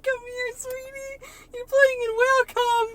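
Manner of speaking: high sweet then alarmed parent voice; mock parent. Parent calling a child from the foam, high mock sweetness. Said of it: "I'm like".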